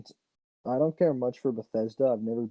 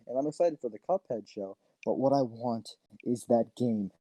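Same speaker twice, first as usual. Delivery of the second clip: ordinary talk, nothing marked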